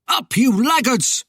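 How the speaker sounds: angry high shout; shouted order, pitched well up. A narrator's reading. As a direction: rouse idle men with a shout. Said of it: "Alan shouted".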